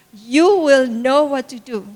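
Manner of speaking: "You will know what to do" slow and deliberate; "You will know what to do" as a slow, firm promise; emphatic reassurance. Retold or imitated. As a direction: give each word of "You will know what to do" its own weight. Mother repeats encouragement to daughter.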